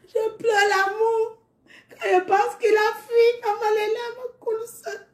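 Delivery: high, slow voice breaking into tears; crying. Real, not acted. Speaker telling the audience of her grief, crying.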